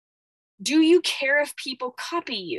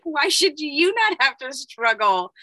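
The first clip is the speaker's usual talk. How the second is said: put-on louder, childish protest; indignant inner child; 4 semitones higher, 6 dB louder than usual. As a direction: protest like a hurt kid. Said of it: "my inner child is like".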